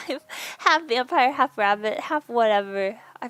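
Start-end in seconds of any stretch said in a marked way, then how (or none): none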